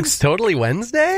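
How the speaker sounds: sharply rising, unsure; uptalk. Shown as a demonstration. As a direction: make the statement a question. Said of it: "when my voice goes up at the end, it means I'm not sure".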